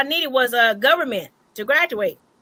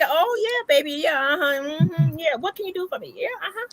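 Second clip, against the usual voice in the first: lightly voiced coy flirting, trailing off soft; flirty sweet-talk; 2 semitones higher, 6 dB quieter than usual. Showing how she sweet-talks her way to a deal, coy and soft. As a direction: purr it like you're working a charm. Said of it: "I use that pretty face".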